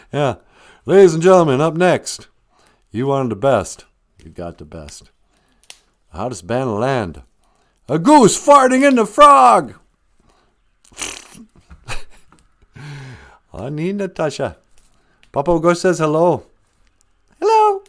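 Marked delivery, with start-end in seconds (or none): 0.9-9.7 hammed-up rock concert announcer, loud on the band name. Host introducing the joke band name as a rock announcer, hammed-up. 17.4-17.8 a much higher put-on hello; squeaky puppet hello. Papa Wagosh greeting Natasha in a high put-on voice.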